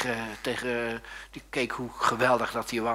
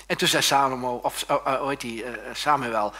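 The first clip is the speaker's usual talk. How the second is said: ordinary talk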